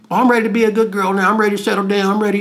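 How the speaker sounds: high, quick mocking quote; mock good girl. Mocks women claiming they will settle down.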